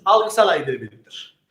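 Algısal ayrıcalıktır said low and slow; slow, weighty answer. Shown as a demonstration. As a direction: drop low and spell it out slowly. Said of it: "Ah, bu ne biliyor musunuz?".

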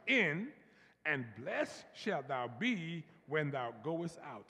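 slow congregational scripture reading; read slowly. Shown as a demonstration. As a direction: stretch every phrase out. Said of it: "read it nice and slow".

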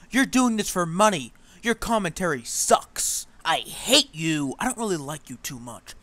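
hammed-up angry rant, fading at the end; mock angry yelling. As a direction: shout each accusation like a mock-furious troll, then trail off. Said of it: "he was very angry".

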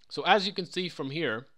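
talking with something in mouth; words round a mouthful; mouth full. Real, not acted. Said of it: "something in my mouth".

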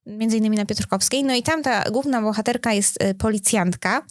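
ordinary talk

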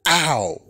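a short high mock yelp of pain. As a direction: yelp "ow" like you just got smacked. Mock pain cries in retelling.

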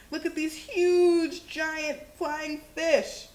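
drawn-out wonder; awed and slow. Acted. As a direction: marvel at it slowly.

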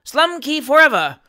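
loud, high rallying chant; roaring crowd. A narrator's reading. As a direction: roar it out for the candidate. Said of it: "roared the honest and independent".

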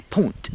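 "punt" with a short oo vowel, pitched high; local pronunciation. Shown as a demonstration. Demonstrating local word for pound, high "punt" with oo. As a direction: pop out "punt" high, oo in place of ow. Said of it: "That's how they pronounce".

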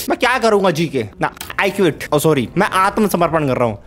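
usual speaking voice

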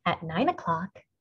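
ordinary delivery

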